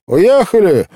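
high, loud blurt; abrupt high-pitched servant's cry. A narrator's reading. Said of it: "брякнула она".

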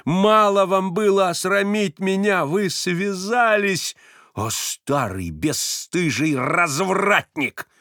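high, slow accusing cry; outraged reproach. A narrator's reading. Varvara accuses Stepan of betrayal, outraged. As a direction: hurl the insult at him. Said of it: "возопила она вдруг".

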